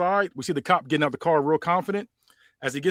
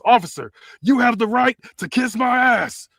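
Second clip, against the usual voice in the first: higher, louder tough-guy challenge; Stone Cold taunt; 9 semitones higher, 6 dB louder than usual. Acted. Stone Cold challenging the cop to a fight, loud and cocky. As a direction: dare him to fight.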